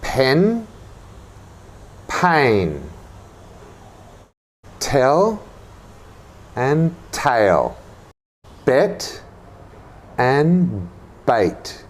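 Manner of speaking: "Pen" short, "pain" gliding, each word set apart; teacher contrasts short and gliding vowels; short vowel against diphthong. Shown as a demonstration. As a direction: model each pair for repetition. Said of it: "listen and repeat after me".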